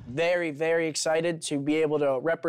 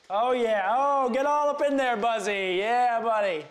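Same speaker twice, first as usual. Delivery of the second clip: high, sing-song gushing; playful, high-pitched fussing; doting pet talk; 6 semitones higher than usual. Real, not acted. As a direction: coo at a beloved buddy in a raised pitch.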